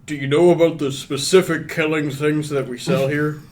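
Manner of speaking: lightly voiced bartender. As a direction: question the customer as a tavern bartender.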